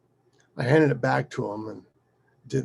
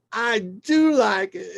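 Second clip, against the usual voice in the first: high, slow insistence; mock defiant child; 10 semitones higher, 6 dB louder, slower than usual. Daughter insisting to her father through lemon, defiant. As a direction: imitate a stubborn little girl protesting. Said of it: "And she goes".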